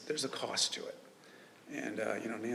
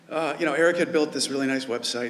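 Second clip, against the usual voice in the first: repeated louder, now close to the mic; 2 semitones higher, 10 dB louder than usual. Real, not acted. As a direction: say it again loud enough for the room. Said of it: "let me step a little closer".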